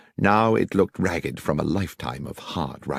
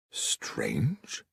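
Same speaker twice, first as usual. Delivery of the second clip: whisper; 4 semitones lower, 9 dB quieter than usual. A narrator's reading.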